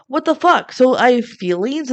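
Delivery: high-pitched mock outrage; indignant outburst. Ellie snapping back at Jack, indignant outburst. Acted quarrel between swapped teens.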